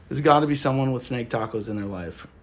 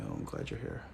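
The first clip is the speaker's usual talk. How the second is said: soft aside; 7 semitones lower, 11 dB quieter than usual. Real, not acted.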